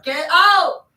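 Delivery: high, loud get out; mock-angry God yelling; shouted order. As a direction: throw him out with a shout. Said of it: "He's like".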